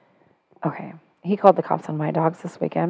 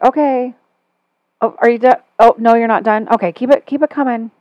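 higher, louder mock patience; frustrated; 5 semitones higher, 5 dB louder than usual. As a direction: goad him on with exaggerated exasperation. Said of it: "like, frustrated".

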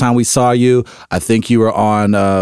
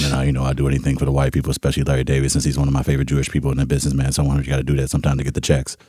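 low, soft, fast athlete impression; Shaq; 7 semitones lower, 5 dB quieter, much faster than usual. Basketball star answering the actor, low Shaq mumble. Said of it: "I'm gonna go Shaq".

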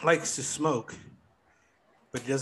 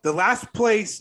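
loud; 10 semitones higher, 9 dB louder, slower than usual. Acted. Hyped rule announcement.

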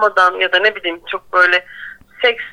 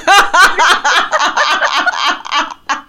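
loud rolling laugh; loud laughter; 6 semitones higher, 4 dB louder than usual. Real, not acted. Laughing hard.

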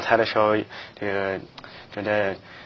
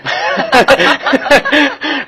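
loud high laugh; 13 dB louder than usual. Real, not acted. Guest laughs at his own story.

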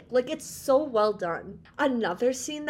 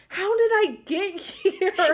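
laughing bewildered quote; 7 semitones higher, 4 dB louder, slower than usual. Retold or imitated. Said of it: "she's like".

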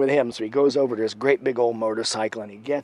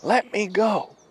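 high put-on voice of the pinned man; imitated high-voiced surrender; grudging captive imitation; 9 semitones higher, slower than usual. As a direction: give in, pitched high. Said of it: "And the other guy goes".

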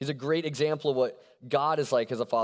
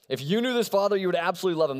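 higher and louder, proclaimed with energy; bold restatement; 6 semitones higher, 4 dB louder, faster than usual. Shown as a demonstration. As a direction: announce it with conviction, up and loud. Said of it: "We're gonna go with it".

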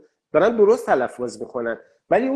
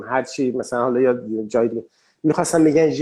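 ordinary talk, nothing marked

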